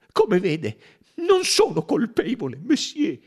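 high, loud triumphant cry; the conductor crying out to Bouc; cry of innocence. Acted. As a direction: cry out in relief that you're cleared. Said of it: "gridò Michel".

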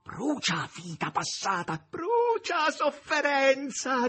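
high, slow cries building to a scream; exultant shouting that climbs and stretches; crying out. A narrator's reading. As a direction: start breathy, build to a long high shriek. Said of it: "gridava Margherita".

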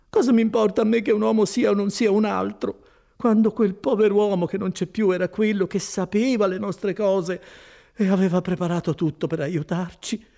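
high, loud woman's protest; high-pitched, aggrieved outburst; impatient, upset Agnese. Acted. Voices Agnese in dialogue.

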